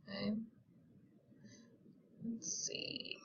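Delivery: ordinary delivery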